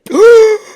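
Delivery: sharp high mock gasp of fright; startled gasp. Clerk sees him rushing at her, loud startled gasp.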